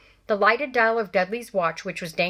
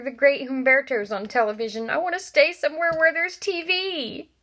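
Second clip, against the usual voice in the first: put-on high, whining spoiled boy; spoiled son whining to his mother; whiny Dudley; 6 semitones higher, 3 dB louder than usual. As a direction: whine it like a spoiled kid wanting TV.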